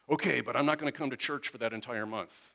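hammed-up stubborn protest; wrong attitude. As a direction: act out a huffy church member objecting. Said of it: "that's the wrong attitude".

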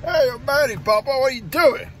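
high, loud voice for the dog; dog's voice. Pretend. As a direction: speak as the excited little dog. Voicing the dog to himself.

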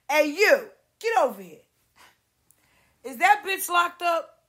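high, loud, sneering call; rude loud summons. Retold or imitated. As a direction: bark at her like a boss lady. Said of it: "that's how they talk to us".

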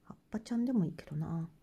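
quick whisper to herself; whispered aside. Real, not acted. Crafter musing to herself, quick whisper. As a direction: breathe it out quickly under her breath.

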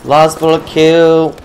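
slow, loud triumphant call; excited shout. Real, not acted. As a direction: drag out the win loudly. Triumphant final kill.

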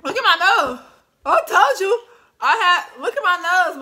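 loud, high, alarmed; loud exclaim. Real, not acted. Showing her runny nose to viewers, loud and high. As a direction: shout for them to look.

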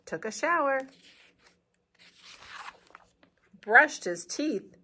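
put-on slow storybook reading for kids; picture-book narration; read-aloud narration. A narrator's reading.

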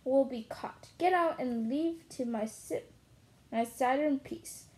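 ordinary delivery

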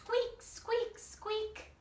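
high, soft squeaky mouse voice; mouse squeak. Shown as a demonstration. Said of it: "What does a mouse say?".

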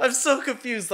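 high, loud laughing words; giggly bewildered. Real, not acted. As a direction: still laughing through it. Laughing at the villain.

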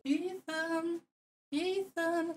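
very high, very soft, distant call of a giant lady; calling Ethan seductively. Pretend. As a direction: coo the name from far away like a temptress.

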